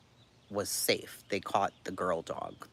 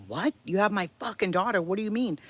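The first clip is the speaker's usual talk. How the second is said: high, loud, fast panicked shouting; panicked yell; 12 semitones higher, 6 dB louder, faster than usual. Retold or imitated. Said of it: "I'm like".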